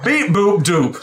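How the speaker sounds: high loud robot beeps. Acted. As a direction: chirp it like a robot. Robot bear speaks again.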